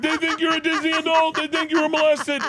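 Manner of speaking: imitating dad wheezing with laughter; dad laughing. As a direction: squeeze the words out through laughter.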